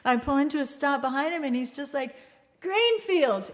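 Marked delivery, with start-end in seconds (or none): 2.6-3.5 put-on high-pitched try at a Massachusetts accent; attempted Massachusetts accent. Storyteller imitating a lost driver's holler, attempted Massachusetts accent. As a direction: call it out with a rough Massachusetts accent. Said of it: "this really thick Massachusetts accent, which I can't duplicate".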